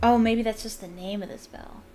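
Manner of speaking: plain reading voice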